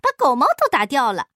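very high-pitched startled scolding; high female character voice, aghast; shocked wife. A narrator's reading. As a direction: shriek in astonishment at the husband. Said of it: "细看之后大吃一惊".

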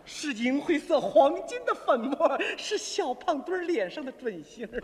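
grandiose, drawn-out poetry recital; inspired poet. Acted. A brother's foot bath sparks a poem, grandiose declaiming. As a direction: declaim each phrase like a fevered poet. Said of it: "激发了我的灵感".

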